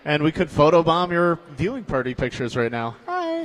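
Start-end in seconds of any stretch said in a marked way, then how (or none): 3.1-3.4 squeaky high greeting; cutesy high hi. Acted. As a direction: chirp it up high. Waving at the camera.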